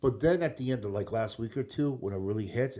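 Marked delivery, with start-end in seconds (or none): none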